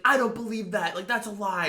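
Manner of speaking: high, accusing mom imitation; yelling. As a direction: act out a mother refusing to believe it. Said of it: "like, yelling at me".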